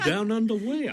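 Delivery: high, laughing mock puzzlement. Warden retelling his reply to the stewardess, laughing. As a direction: ask it through a laugh. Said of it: "And I said".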